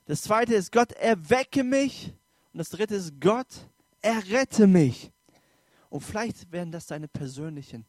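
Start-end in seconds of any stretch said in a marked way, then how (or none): 0.7-2.1 slow, high plea to God; cry to God. Acted. Preacher voicing the second cry to God, slow high plea. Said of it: "so Schreie zu Gott". 3.2-5.1 slow, loud plea for rescue; cry to God. Acted. Preacher voicing the third cry to God, loud slow plea. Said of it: "so Schreie zu Gott".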